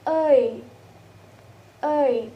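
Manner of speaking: "ơi" as a long falling glide; diphthong demo. Shown as a demonstration. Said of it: "And the second is".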